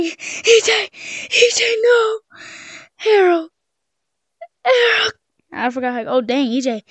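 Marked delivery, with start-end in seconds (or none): 0.0-5.1 alarmed yell. Real, not acted. Calling the runaway pet, high panicked yells.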